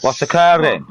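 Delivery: high, loud mock Welsh accent; comic Welsh lilt, pitched right up; imitated Welsh greeting. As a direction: hail someone in exaggerated Welsh. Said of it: "They literally just walk up to each other and go".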